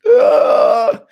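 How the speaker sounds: loud, high disgusted groan; mock exasperated ugh. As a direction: groan at the silly hack. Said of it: "And I'm just like".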